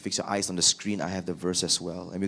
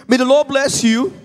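much higher and louder, syrupy greeting; falsely cheerful; 11 dB louder than usual. Acted. Saul greeting Samuel, prideful and cynically cheerful. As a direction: greet him with proud, fake cheer. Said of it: "Saul greeted him cheerfully".

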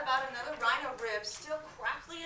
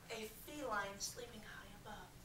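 whisper; 2 semitones lower, 11 dB quieter than usual. A narrator's reading. Hushed sleeping cat.

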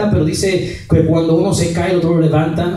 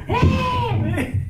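crowd's cheer, imitated; 5 dB quieter than usual. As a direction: mimic a roomful squealing "eh". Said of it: "Y todo el mundo".

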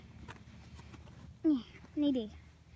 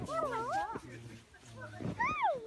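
much higher cooing hello; high pet greeting; 13 semitones higher, 8 dB quieter than usual. Real, not acted. Girl coos hello to an animal.

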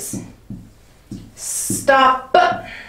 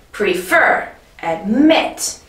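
"prefer, admit" with FER and MIT punched, rising; stress on second syllable; slower than usual. Shown as a demonstration. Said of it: "We care about the emphasis here".

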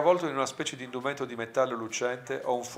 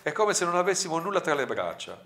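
ordinary delivery, nothing marked

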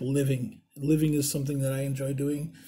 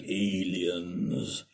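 low, very slow, mock-mysterious; spooky drawn-out word; 8 semitones lower, 4 dB quieter than usual. Host teasing viewers with a live video topic, mock-mysterious. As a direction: stretch it out deep like an eerie teaser.

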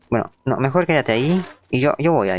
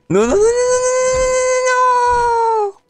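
shrill high desperate no's, much louder; desperate scream; 5 dB louder, slower than usual. Real, not acted. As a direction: wail the no's in panic. Grito al perder vida. (grito desesperado)

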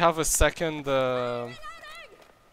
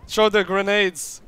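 loud high urgent command; shouted order; 9 semitones higher, 11 dB louder, slower than usual. Real, not acted. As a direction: bark it to the team. Calling orders mid-fight.

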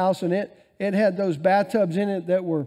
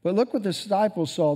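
ordinary talk

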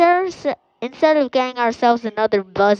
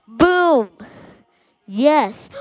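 usual speaking voice

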